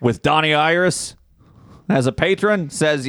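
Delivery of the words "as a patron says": ordinary delivery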